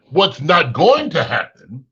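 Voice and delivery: ordinary talk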